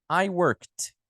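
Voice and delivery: "worked" said "workt", one syllable; -ed pronounced as T. Shown as a demonstration. Teacher translating "eu trabalhei" for students, "worked" ending in a T. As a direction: end "worked" on a released T. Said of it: "você vai pronunciar com T".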